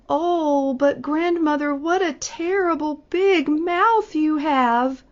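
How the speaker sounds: put-on girl terrified by the huge mouth; frightened. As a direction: let the fear climb.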